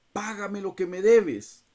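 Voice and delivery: harsh demand. Acted. Servant seizes fellow servant by throat.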